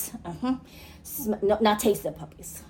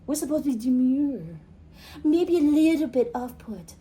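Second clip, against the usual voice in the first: ordinary talk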